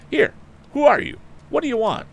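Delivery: loud high shout; yell. A narrator's reading. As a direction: challenge the intruder at full voice. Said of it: "yelled Tom".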